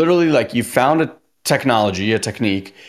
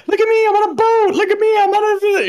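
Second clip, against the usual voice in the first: much higher, giddy show-off voice; very high gushing brag; mock show-off; 3 dB louder than usual. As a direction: squeal it like a thrilled influencer. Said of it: "you're like".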